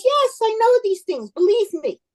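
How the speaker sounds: high-pitched, insistent know-it-all; emphatic assurance. Acted. Rabbi insists on his expertise.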